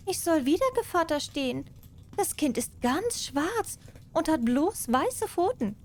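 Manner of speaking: butter the mouse up as the cat. Acted. Cat asks again, high voice.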